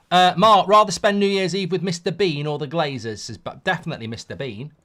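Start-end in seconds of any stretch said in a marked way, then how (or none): none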